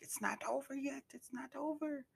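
very high, thin put-on voice, kept soft; mock a high-pitched warning, softly; small falsetto mimicry; imitated warning cry. Acts warning that fight isn't over.

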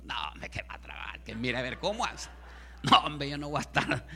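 low, husky muttered objection, lightly acted; mock grumbling refusal. Imagined believer balking at giving money to the pastor, mock grumbling. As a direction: grumble under the breath as a reluctant giver.

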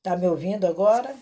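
loud, fast check-in; calling out. Real, not acted. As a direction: call out over a failing line. Checking audio on failing call.

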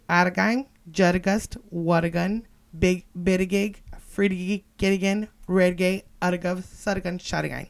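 slow, separated words with gaps. Shown as a demonstration. Reading the shirt in double Dutch, word by word. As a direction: break the coded phrase into single words.

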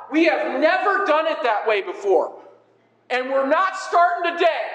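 high, indignant traditionalist; stubborn church member. Acted. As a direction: dig your heels in like an offended churchgoer. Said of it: "[name] here is a superb church member".

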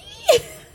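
sudden high laugh; high burst of laughter. Real, not acted.

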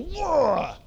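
high startled whoa, cartoon dad; a lightly voiced cartoon dad's surprised whoa; Homer Simpson whoa. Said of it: "Lots of Homer Simpson moments".

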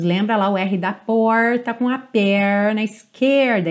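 ordinary delivery

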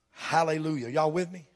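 normal talking voice

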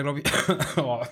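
sudden real cough mid-sentence; coughing fit. Real, not acted. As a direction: cough breaks into the words. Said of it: "das kommt passend hier". (plötzlicher Hustenanfall)

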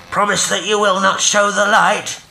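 high, loud call from afar; distant pleading voice. A narrator's reading. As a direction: plead across the water. Distant voice calls back.

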